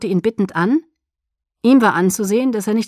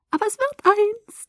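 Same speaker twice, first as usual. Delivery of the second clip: very high, singsong glee; chirpy, lilting triumph; trilled. A narrator's reading. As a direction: trill it gleefully, far up in pitch. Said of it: "trällerte sie".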